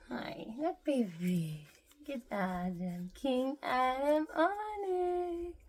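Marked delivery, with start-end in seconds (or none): none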